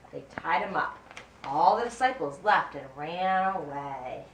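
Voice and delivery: plain reading voice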